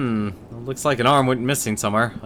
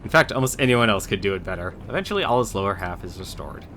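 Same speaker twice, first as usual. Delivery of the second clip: ordinary delivery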